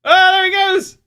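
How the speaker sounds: high, loud burst of excitement; excited shout. Real, not acted. Dog takes off running, excited shout.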